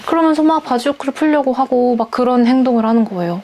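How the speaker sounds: ordinary delivery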